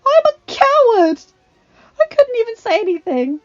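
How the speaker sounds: high, strained choking voice; choked out. A narrator's reading. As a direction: force the words through tears. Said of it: "she choked out".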